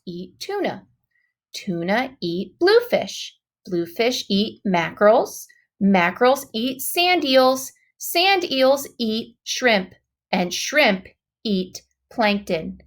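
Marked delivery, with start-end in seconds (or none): none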